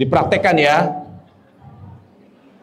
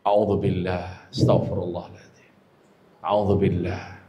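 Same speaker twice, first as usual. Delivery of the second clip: low soft murmured prayer; 13 semitones lower, 8 dB quieter than usual. Shown as a demonstration. Wife swallowing her anger, soft murmur. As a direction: keep murmuring to hold the anger down. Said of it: "berupaya meredam".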